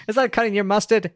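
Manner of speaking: high squeaky pushy salesman. Acted. Squeaky knife salesman hassling restaurant staff. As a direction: pitch it up into a squeak and hustle. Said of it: "My, my squeaky voice is terrible".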